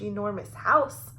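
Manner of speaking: usual speaking voice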